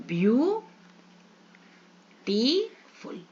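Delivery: "View", long pause, "टी", then "फुल"; "ब्यू-टी-फुल" with long rising first beats; split into three syllables. Shown as a demonstration. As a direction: draw out "beautiful" extremely slowly, syllable by syllable. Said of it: "आप three syllable word हो गया".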